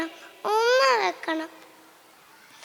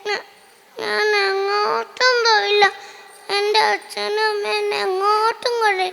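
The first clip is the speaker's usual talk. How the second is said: put-on crying child. Little girl crying to teacher about no holiday trip. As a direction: cry through the words as a hurt little girl. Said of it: "എന്തിനാ കരയണത്".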